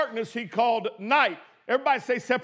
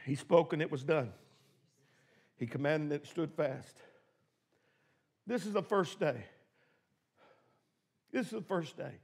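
much softer and lower, almost murmured; hushed, low recap; 9 semitones lower, 10 dB quieter, faster than usual. Acted. Preacher quietly restating creation to the church. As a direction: drop right down and let it settle.

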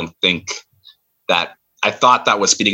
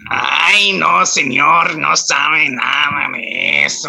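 put-on higher, drawn-out caricature Mexican accent; hammed-up cartoon Mexican accent, badly off the mark; bad Speedy Gonzalez accent; 3 semitones higher, much slower than usual. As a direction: overdo a pleading Mexican accent. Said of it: "give me your best Speedy Gonzalez impressions".

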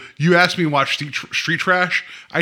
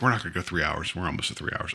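muttered aside; 7 semitones lower, 10 dB quieter, faster than usual. Retold or imitated. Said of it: "I was like".

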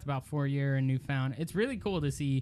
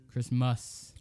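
put-on Australian accent; 3 semitones lower than usual. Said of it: "Are you trying to do an Australian accent?".

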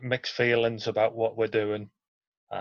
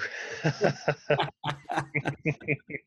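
long hearty chuckle; laughing; 3 semitones higher, 3 dB quieter than usual. Real, not acted. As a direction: laugh at the interviewer's question. Reaction to the loudhailer question.

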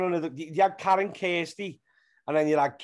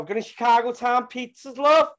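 higher and louder Scouse woman's voice, stretching out love; broad Scouse woman; 8 semitones higher, 6 dB louder than usual. Retold or imitated. Comic sketch for Facebook, broad Scouse woman with a rising love. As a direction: play up the Liverpool shop chatter. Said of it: "people in Liverpool speak like that".

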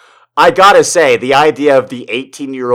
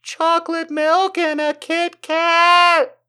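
put-on much higher, slow little-kid voice; childish high voice; 5 dB louder, slower than usual. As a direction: whine it like a small child. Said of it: "It's like".

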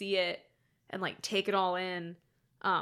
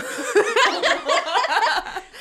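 high loud laugh; 11 semitones higher, 9 dB louder than usual. Real, not acted. Laugh after chant.

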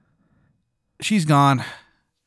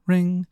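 high-pitched imitation ringtone; spoken phone ring; 7 semitones higher than usual. Game master voicing the player's phone, imitation ring. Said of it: "is just you saying ring".

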